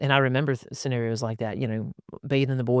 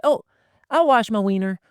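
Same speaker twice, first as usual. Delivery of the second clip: put-on kid voice; 9 semitones higher, 5 dB louder than usual. Child voiced in bath.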